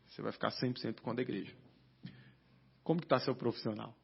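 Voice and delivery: a hint of a grave pastor explaining the calling; hushed pastor voice. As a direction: lay it out quietly like a solemn pastor. Pastor's calm serious talk retold.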